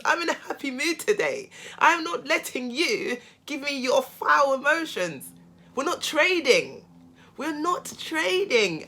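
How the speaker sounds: patronize him sweetly, drawing it out; mocking sweetness. Mocking the angry narcissist.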